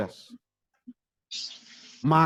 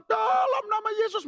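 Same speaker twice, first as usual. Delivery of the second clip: mock rebuke. Imitating Christians rebuking the storm.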